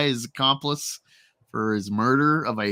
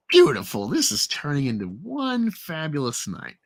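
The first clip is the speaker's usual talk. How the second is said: ordinary delivery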